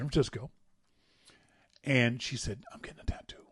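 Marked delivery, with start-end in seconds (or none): 2.7-3.5 hushed, soft whisper; whispered quote. Retold or imitated. Friend quietly announces her tattoo, whispered. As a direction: confide it like a secret.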